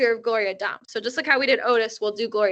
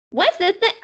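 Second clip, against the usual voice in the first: put-on high, eager little girl; 8 semitones higher, 4 dB louder than usual. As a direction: try the little kid voice again. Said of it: "not hitting my Sweetie Pie Thomas voice".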